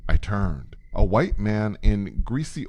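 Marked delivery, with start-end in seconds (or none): none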